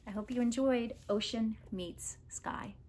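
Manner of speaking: soft whispered farewell; unvoiced whisper to the listener. Real, not acted.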